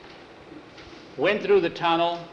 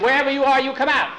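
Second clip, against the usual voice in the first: calling out; 10 semitones higher, 6 dB louder, faster than usual. Acted. He says he's getting mad.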